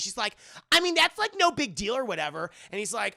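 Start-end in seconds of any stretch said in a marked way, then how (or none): 0.7-2.5 dismissive mock quote. Anastasia shrugging off danger.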